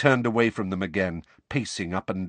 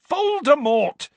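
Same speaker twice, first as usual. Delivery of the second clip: high, loud, drawn-out furious shout; 7 dB louder, slower than usual. A narrator's reading. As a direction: shout the name in fury. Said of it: "said Harry furiously".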